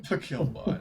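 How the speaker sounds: laughing echo. Real, not acted. GM laughing as he echoes the player's joke. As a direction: repeat it through a laugh.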